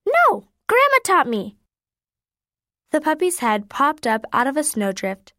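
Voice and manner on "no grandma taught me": put-on high, louder child's voice; little boy voice. A narrator's reading. Little boy telling Mama who taught him, high kid voice. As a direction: answer like an innocent six-year-old.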